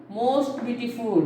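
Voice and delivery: usual speaking voice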